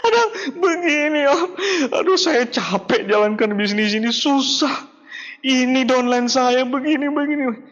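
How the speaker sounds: high, teary mock sobbing with laughs; crying downline. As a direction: whine the complaint through fake tears. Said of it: "dengar orang nangis". (downline menelepon sambil menangis tersedu-sedu)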